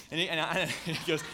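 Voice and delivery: can't hold back a laugh while telling it. Real, not acted. A pastor cracking up while retelling his son's words, laughing.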